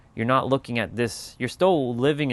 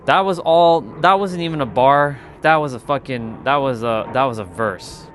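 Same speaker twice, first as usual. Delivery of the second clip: hyped reaction; 3 semitones higher, 8 dB louder than usual. Real, not acted. Hyped reaction to a played beat.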